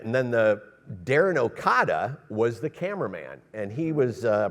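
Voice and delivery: normal talking voice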